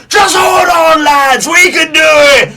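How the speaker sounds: high, loud rallying yell; rally the crew over a howling storm; shouting captain. Acted. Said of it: "And the captain's like".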